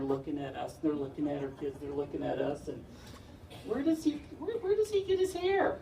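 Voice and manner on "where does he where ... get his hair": high, wide-eyed curious stranger voice; puzzled stranger in a high squeak; nosy stranger. Retold or imitated. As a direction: play the baffled onlooker, way up high.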